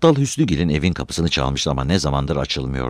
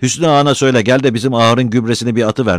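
loud, raised request thrown after him; high, carrying voice; calling after someone; 7 semitones higher, 6 dB louder, faster than usual. A narrator's reading. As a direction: call out an errand to someone leaving. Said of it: "diye seslendi kadın arkasından".

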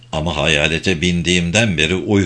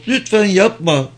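pleading cry; 10 semitones higher than usual. A narrator's reading. Maud begging Humphrey not to kill: high pleading cry. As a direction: beg him to stop, voice up high. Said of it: "diye bağırdı o".

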